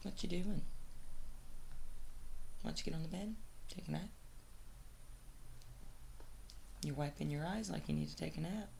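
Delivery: high, gentle pet talk. Real, not acted. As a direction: coax the dog kindly. Talking to dog Jelly.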